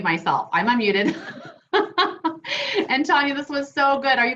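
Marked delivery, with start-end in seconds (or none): 1.7-2.8 short, high-pitched burst of laughter; amused laugh. Real, not acted. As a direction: laugh at your own slip. Laughing at own muting slip.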